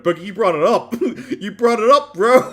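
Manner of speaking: high, loud laughing protest. Real, not acted. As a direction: laugh it out at him.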